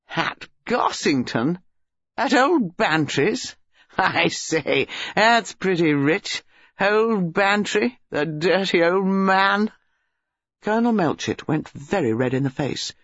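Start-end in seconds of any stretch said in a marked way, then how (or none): 0.1-9.7 slow, relishing drawl; gleeful young man, scandalized mirth. Acted. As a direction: savour the scandal with mocking delight.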